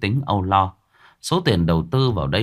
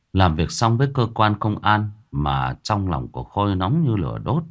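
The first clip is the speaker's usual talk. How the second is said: ordinary delivery, nothing marked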